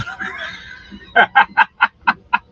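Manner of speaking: high breathy burst of laughter; loud laugh. Real, not acted. Laughs at own joke.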